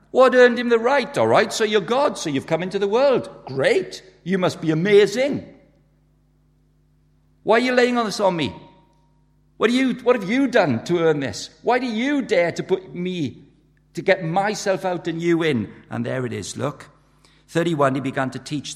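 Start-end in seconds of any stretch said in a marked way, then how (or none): 0.1-5.4 high, loud mock-impressed challenger; acted incredulous objection; sceptical challenge. Acting a sceptic challenging Jesus. 7.4-15.7 high-pitched, protesting challenger; acted outraged demand to know why; indignant objection. Acting an objector confronting Jesus.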